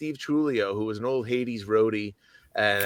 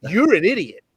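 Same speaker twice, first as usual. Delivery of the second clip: exasperated; 9 semitones higher, 7 dB louder than usual. Retold or imitated. Retold exasperated retort.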